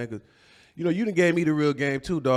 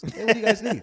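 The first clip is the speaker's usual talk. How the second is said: code-switch into a higher office voice; real estate voice; 9 semitones higher, 4 dB louder than usual. Acted. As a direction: put on a squeaky-polite realtor voice. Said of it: "I put my real estate voice on".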